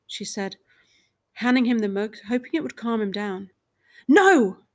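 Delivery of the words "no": a much louder, higher "No!"; shouted. A narrator's reading. Husband shouts and throws the mug. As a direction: shout it in anger. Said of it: "he shouted".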